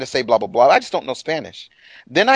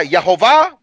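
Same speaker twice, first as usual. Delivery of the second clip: Spanish reading; 2 semitones higher, 7 dB louder than usual. Retold or imitated. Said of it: "in Spanish".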